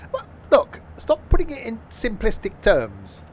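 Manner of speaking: high, loud, whining voice; mock whiny protest. Playing the moaner's indignant reply, whining high. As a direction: ham up a petulant complaint.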